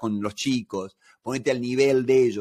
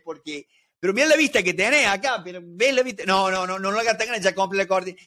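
alarmed protest; 6 semitones higher, faster than usual. Acted. Builder and client squabbling over the house, high alarmed protest. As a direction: pitch it up like you're stopping a disaster mid-build.

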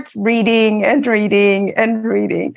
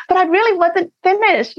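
normal talking voice

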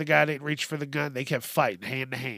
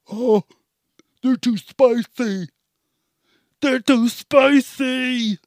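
much higher, louder, slow put-on whine; mocking whiny husband; 9 semitones higher, 7 dB louder, slower than usual. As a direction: whine like a picky child. Mocking husband complaining about nachos.

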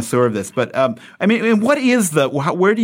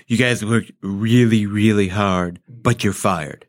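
low, slow attempt at Trump; lightly voiced Trump try; Trump impression attempt; 3 semitones lower, slower than usual. Shown as a demonstration. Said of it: "Show me how to do it".

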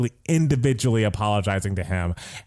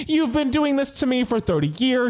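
higher, sing-song mocking whine; imitating a huffy friend, pitched way up; mock whiny Becky; 11 semitones higher than usual. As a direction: lightly parody a defensive rant in a higher voice. Mocking Becky's defensive rant.

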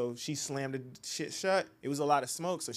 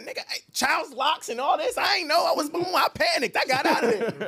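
high, loud, flustered imitation; husband imitating his wife's panicked excuse; 12 semitones higher, 5 dB louder than usual. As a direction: mimic her panicked excuse. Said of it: "She said".

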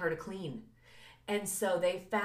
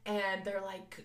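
usual speaking voice

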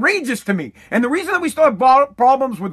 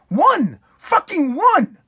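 angry emphasis; 6 semitones higher, much slower than usual. Real, not acted. Angry rant about dead voters.

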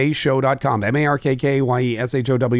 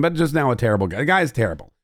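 ordinary delivery

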